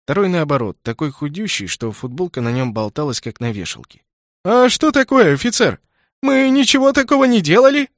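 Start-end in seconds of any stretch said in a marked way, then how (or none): 4.4-7.9 high squeaky voice, loud and wailing; squeaky whining voice. Acted. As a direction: whine it like a squealing fat kid. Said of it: "писклявым голосом запричитал толстяк".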